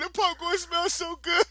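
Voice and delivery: high, slow mock whimper; mock crying kid. Imitating his kid brother crying over popcorn, high slow mock whimper. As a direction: whimper it like a hungry little kid. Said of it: "And he's like".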